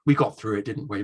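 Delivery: a lightly voiced old English actor saying dear boy; genteel actor. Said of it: "He said".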